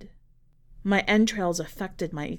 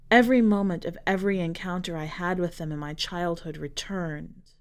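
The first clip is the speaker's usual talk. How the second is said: ordinary delivery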